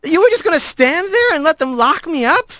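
betrayed disbelief; hurt young rat. A narrator's reading. Young rat accusing the girl, high and hurt.

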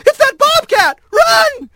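very high panicked cry; frantic, high-pitched scream to flee; panicked alarm. A narrator's reading. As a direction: shriek a warning to everyone nearby. Crowd member raises the alarm.

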